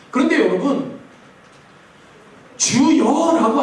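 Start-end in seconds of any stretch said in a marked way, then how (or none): 2.6-3.6 "주여" said slowly and weightily; emphasized word. Shown as a demonstration. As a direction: lean hard on "주여" slowly. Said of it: "하는 표현이 되게 중요한 표현".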